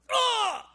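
high, loud mock cry of a man falling; a sudden high yelp as he drops; imitating a collapsing scream. Man collapses under the power of God, loud high yelp. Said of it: "Just went".

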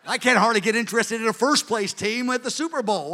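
usual speaking voice

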